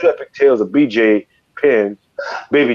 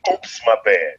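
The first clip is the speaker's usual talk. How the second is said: usual speaking voice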